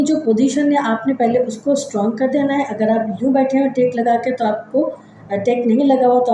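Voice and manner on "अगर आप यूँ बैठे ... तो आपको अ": usual speaking voice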